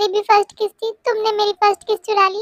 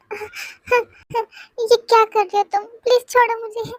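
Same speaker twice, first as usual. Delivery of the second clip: frightened pleading; 5 semitones higher than usual. Acted. Jimin grabbed, begging to be released.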